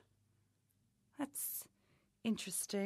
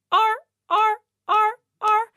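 high, loud seal bark; barking seal noise; 11 semitones higher, 8 dB louder than usual. Acted. Coach imitating a baby seal for Hannah, high loud barks.

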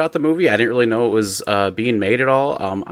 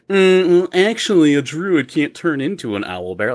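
high, nasal-free mock know-it-all; nerdy gatekeeper; 5 semitones higher than usual. Mocking gatekeeper fans correcting movie lore, know-it-all voice. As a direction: sneer like a pedantic gatekeeper. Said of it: "gatekeepers who are like".

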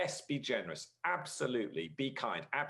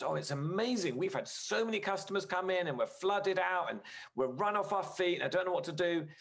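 excited rush; 6 semitones higher than usual. Retold or imitated. Pastor imitating Edgar gushing about sudden customers, excited. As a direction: gush about business booming. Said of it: "And he talks like this".

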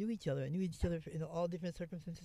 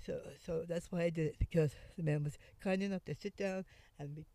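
ordinary talk, nothing marked

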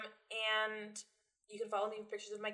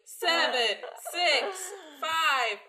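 rising, louder and higher countdown; new year countdown crowd; 7 semitones higher, 9 dB louder, much slower than usual. Acted. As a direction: count down building to a cheer. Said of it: "counting down the seconds".

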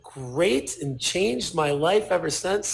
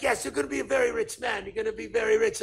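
higher-pitched imitated Japanese accent; 6 semitones higher, faster than usual. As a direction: insist like a Japanese businessman, accent put on. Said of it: "here's my Japanese accent".

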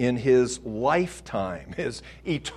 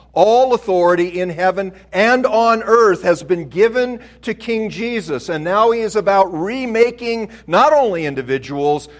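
loud, high, ringing pulpit proclamation; full-force preaching; 6 semitones higher, 9 dB louder than usual. Acted. Pastor to the men of the church, preaching at full force. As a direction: preach it out to the whole room.

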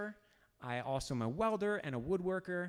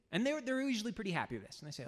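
ordinary talk

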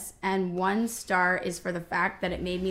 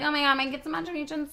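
exasperated mom; 7 semitones higher, faster than usual. Retold or imitated. Mother voiced, exasperated.

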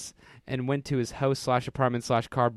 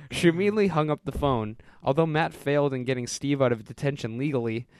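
ordinary talk, nothing marked

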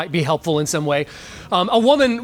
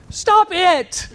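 puzzled; 10 semitones higher than usual. Acted. Patient repeats the cure, puzzled.

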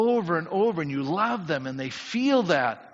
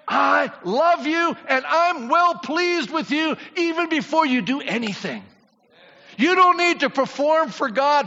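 higher, louder, shouting preacher; full-force preaching; 7 semitones higher, 4 dB louder than usual. Acted. Pastor urging the congregation that God already approves them, shouting. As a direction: preach it out with fire.

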